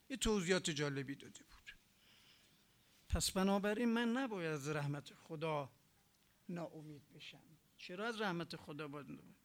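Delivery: ordinary talk, nothing marked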